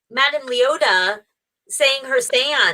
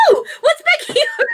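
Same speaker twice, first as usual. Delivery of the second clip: laughing. Pretend. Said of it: "I would be like".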